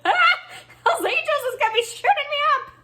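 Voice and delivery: shrieked very high and loud, fast; hammed-up panic shriek; mock terror. Imagining gangsters coming after her, mock screaming. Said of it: "I was like".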